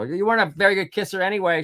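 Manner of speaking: high mom impression, cheeky; mom's voice. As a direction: toss the line off teasingly. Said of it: "she says".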